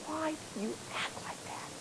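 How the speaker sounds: barely voiced, hushed ask; hushed question to a crying senior; soft gentle question. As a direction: ask it almost under the breath.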